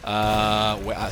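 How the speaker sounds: normal talking voice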